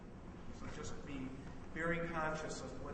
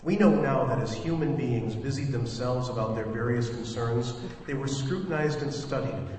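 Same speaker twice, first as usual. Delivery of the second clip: louder, lower-pitched radio narrator; story narration; 3 semitones lower, 12 dB louder than usual. Acted. Director opens the radio play for listeners, projected story narration. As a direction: deliver it as a measured, projected prologue. Reads the drama's opening narration.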